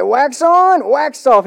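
lightly acted, loud karate master's instruction; retold coach's command. Coach repeats drill in retold film.

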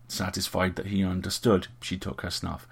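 ordinary delivery, nothing marked

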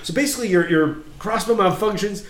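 climbing into a loud, high-pitched retelling of the mishap; excited build to a shout. Retold or imitated. Game master to his friend, shouting the crossbow misfire. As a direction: shout it out like the shot just hit you.

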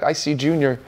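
low, subdued mock-wimpy reply; wimpy, weak. Preacher voicing Junior at the mirror, wimpy and weak. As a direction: sound a little beaten down, lightly acted. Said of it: "wimpy and, and weak".

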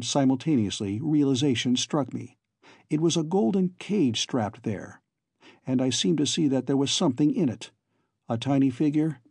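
usual speaking voice

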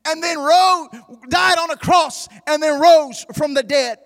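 loud, sung-out gospel preaching; full-force preaching. Acted. Preacher to congregation, singing out full-force preaching. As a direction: preach it over the music, big and loud.

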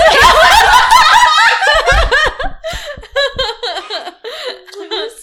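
loud, high burst of laughter; long, helpless cackling laugh; big laugh. Real, not acted. Mother cracking up over grandma and bad words, real laughter.

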